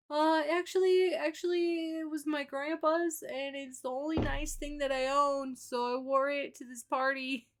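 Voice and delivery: high, nervous little man; squeaky, sheepish answer; meek character voice. Pretend. Voicing Payday answering shyly.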